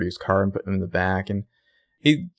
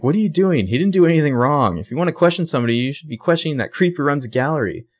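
indignant protest; 6 semitones higher, faster than usual. Retold or imitated. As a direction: push back hard at the cop like a hurt friend. Spencer defends Johnny to Toby.

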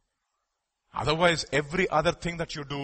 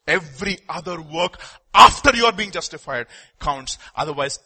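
ordinary talk

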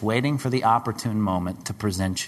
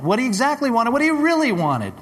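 climbing higher and louder; building preacher climax; 12 semitones higher, 4 dB louder than usual. Real, not acted. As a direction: build it up to a peak.

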